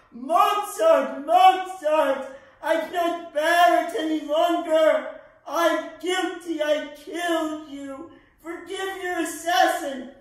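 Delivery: loud, high, guilt-ridden shout; anguished cry. Acted. Guilty man begging dead Mozart's forgiveness, anguished shouting. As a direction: cry out a confession at full force.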